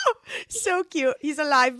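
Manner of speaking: high, loud squeal of delight; retelling her own delighted reaction; excited gush. Retold or imitated. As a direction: gush like a fan at a happy reveal. Gushing over a book note.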